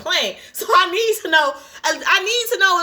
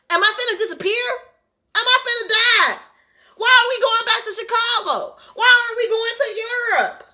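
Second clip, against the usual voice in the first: spiral into half-laughing panic; mock panic; 6 semitones higher than usual. Real, not acted. Reader panicking about the plane, pitched up. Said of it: "I'm getting hyperventilating".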